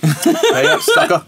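high, laughing taunt; gloating laugh. Real, not acted. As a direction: crow it through a laugh. Bet win, said twice.

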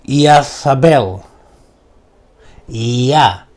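slow, drawn-out magic word; slow incantation. Shown as a demonstration. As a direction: intone it like a spell. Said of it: "Vamos con la tercera".

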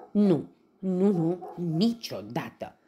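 loud, high protest; emphatic refusal. A narrator's reading. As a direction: refuse outright, loudly. Fleas refuse the plan. (refuz hotărât)

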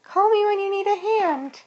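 very high, squeaky bird voice; bird character. A narrator's reading. Bird says goodbye.

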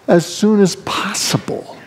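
slow, hushed little plea; breathy child's whisper of a wish; pleading whisper. Acted. The kids pleading for a trip.

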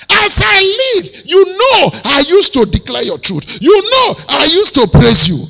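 high loud desperate plea; loud tearful pleading; pleading shout. Acted. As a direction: beg God at full voice. King pleading with God.

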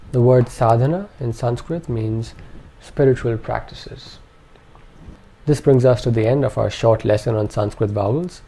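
ordinary talk

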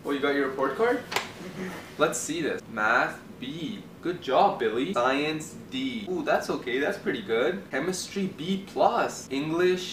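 low, soft and slow praise; proud dad. Acted. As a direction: praise him warmly. Western dad praises report card.

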